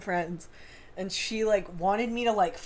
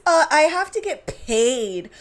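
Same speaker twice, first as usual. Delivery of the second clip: put-on high, loud whining baby voice; whiny baby voice; 3 semitones higher, 6 dB louder, slower than usual. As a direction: whine it like a spoilt baby. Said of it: "little whiny baby voice".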